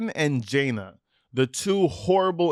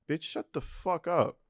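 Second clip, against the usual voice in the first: ordinary talk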